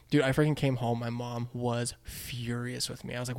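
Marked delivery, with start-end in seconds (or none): none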